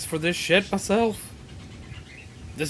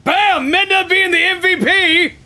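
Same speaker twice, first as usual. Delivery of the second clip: high, loud cheering for the winning move; excited cheer; 9 semitones higher, 3 dB louder than usual. Real, not acted. Gamer cheering his Pokemon's winning move, excited. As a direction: hype up the MVP.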